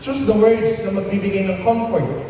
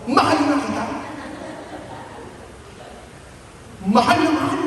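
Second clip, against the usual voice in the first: sweet, higher "Mahal na kita"; a touch of a doting tone; tender; 7 semitones higher, 4 dB quieter, faster than usual. Shown as a demonstration. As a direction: say "Mahal na mahal" lovingly, with a laugh. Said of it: "Eighty percent yung tono".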